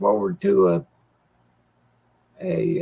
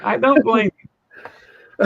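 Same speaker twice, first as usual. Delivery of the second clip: laughing; 3 semitones higher, 5 dB louder, much slower than usual. Real, not acted. Laughing along at co-host's joke.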